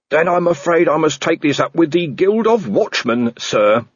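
dutiful junior reporting to his boss; earnest constable. Acted. Constable Shu voiced in story.

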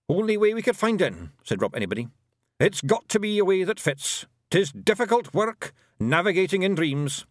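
high, cocky Scots brogue; a proud wee Feegle warrior; broad Scots Feegle; Scottish pixie voice. A narrator's reading. Rob Anybody's Feegle voice.

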